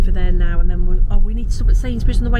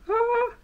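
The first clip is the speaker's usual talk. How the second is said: delighted squeal; 10 dB quieter than usual. Real, not acted. Reacting to lovely baby news.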